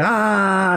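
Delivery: high, drawn-out mock shout; mock yell at no great volume; imitated cry. Imitates cry after breaking ice.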